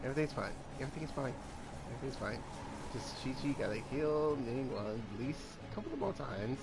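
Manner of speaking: low, soft muttering to oneself; hushed, low-pitched coping talk; quiet self-reassurance. Real, not acted. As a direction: talk yourself down under your breath. Reassuring self under pressure in game.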